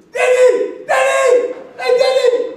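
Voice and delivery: high loud starstruck yell; fan yelling. Retold or imitated. Fan screaming at Danny Blind from half a metre, loud high yell. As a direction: holler at the player like a delirious fan. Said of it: "Hoor ik mezelf uitkramen".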